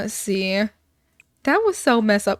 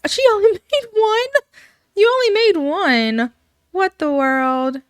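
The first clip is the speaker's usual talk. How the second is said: higher, louder indignant surprise; hammed-up disbelief; mock outrage; 9 semitones higher, 5 dB louder than usual. Family spotting only one salsa, mock outraged.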